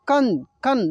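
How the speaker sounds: कन said with a clear nasal न at the end; nasal sound demo. Shown as a demonstration. Teacher shows students the bindi sound, nasal कन. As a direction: sound out कन through the nose to show the bindi. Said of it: "बिंदी यहाँ पे लगे तो कैसे बोलेंगे".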